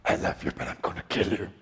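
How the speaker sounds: soft, whispered mock threat; whispered playful wife threat; exasperated whisper. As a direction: hiss it through a loving smile. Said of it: "Ange gets like".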